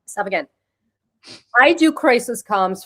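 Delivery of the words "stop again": usual speaking voice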